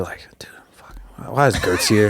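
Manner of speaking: hushed nervous whisper. Retold or imitated. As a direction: whisper it like a worried sparring partner. Guys whispering about Gertz arriving.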